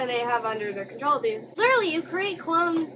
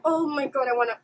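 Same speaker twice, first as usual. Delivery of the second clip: ordinary talk, nothing marked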